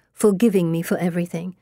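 ordinary delivery

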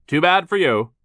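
high, loud taunt; callous dismissal. Acted. Foreman shrugs at Klaus, callous and loud. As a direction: brush him off loudly.

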